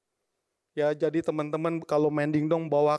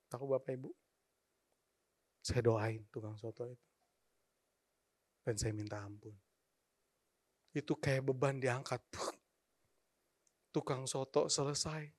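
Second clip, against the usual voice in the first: hushed and softer, near tears; tearful memory; 3 semitones lower, 10 dB quieter than usual. Retold or imitated. Preacher recalls forgiveness, voice much softer and tearful. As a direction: let it sink, moved and quiet. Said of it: "saya nangis".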